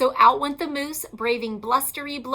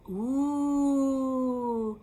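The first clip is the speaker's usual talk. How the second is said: ooh and ah; 4 semitones lower than usual. A narrator's reading. Family marvels at lit tree.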